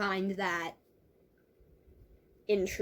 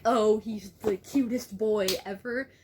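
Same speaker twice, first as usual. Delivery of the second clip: louder, hammed-up gushing; mock super dramatic; 5 dB louder than usual. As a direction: gush like a melodramatic teen heroine. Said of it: "super dramatic".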